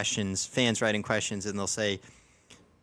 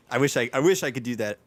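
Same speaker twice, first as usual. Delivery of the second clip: usual speaking voice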